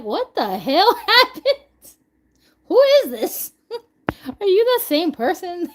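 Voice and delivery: high, loud, laughing mock shock; incredulous and high-pitched, breaking into laughter; shocked disbelief. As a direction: react in hammed-up bewilderment. Acting shocked listener to accent switch.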